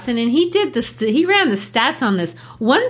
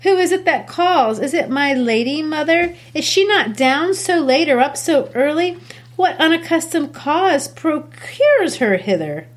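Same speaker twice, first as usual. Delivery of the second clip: lightly voiced young Juliet, a bit higher; teacher reading Juliet aloud in class, a little higher; 6 semitones higher than usual. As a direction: read Juliet's lines with a touch of alarm. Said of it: "I'll read Juliet if you'll read the mom".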